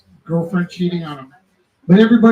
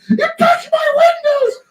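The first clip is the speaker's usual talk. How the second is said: much higher, louder crying accusation, hammed up; frantic store lady; 4 dB louder than usual. Store lady accusing the teller over her windows, much higher crying mock shout. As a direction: accuse him in tearful outrage. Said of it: "And then she".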